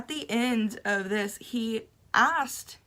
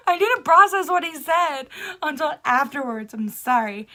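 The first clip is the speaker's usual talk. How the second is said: higher, louder rushed explanation; flustered excuse; 4 semitones higher, 8 dB louder than usual. Retold or imitated. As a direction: blurt the excuse defensively.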